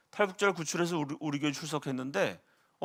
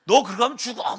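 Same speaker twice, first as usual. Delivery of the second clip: mock yell; 5 semitones higher, 6 dB louder than usual. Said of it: "막 소리를 막 지르고".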